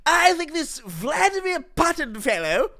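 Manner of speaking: high, sing-song put-on posh voice; lofty mock-British drawl about world affairs; mock posh British pundit. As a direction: ham up a pompous British commentator. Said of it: "It's my hidden Xperia impression".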